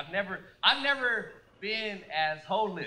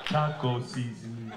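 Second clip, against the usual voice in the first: low, defeated mumble of a beaten-down husband; 7 semitones lower than usual. Retold or imitated. Husband at the grocery store, low and defeated. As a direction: sigh it out like a broken man. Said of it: "he just goes".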